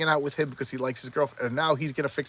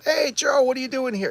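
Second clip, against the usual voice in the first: high-pitched, loud imitated hello; surprised greeting; 10 semitones higher, 3 dB louder than usual. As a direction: act out bumping into a friend unexpectedly. Acting girl greeting Joe at show.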